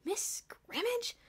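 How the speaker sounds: slow incredulous whisper; shocked whisper. A narrator's reading. A student murmuring in shock, whispering. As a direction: whisper it in disbelief.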